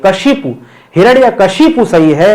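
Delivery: ordinary delivery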